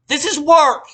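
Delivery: loud, high snap back; loud firm retort; sharp retort. Retold or imitated. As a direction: put her in her place. Snapping back at coworker.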